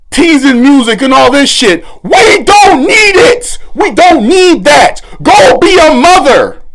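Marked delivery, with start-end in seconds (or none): 2.0-5.0 slow, high yelled dismissal; angry shout. Real, not acted. Creator shouting down the teasing music, angry. 5.2-6.6 angry command. Real, not acted. Ordering her to parent.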